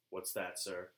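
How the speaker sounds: low, hushed question; Elmer Fudd. Retold or imitated. Fudd asking at the committee, low hushed. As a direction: ask it softly like a hunter. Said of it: "from Elmer Fudd".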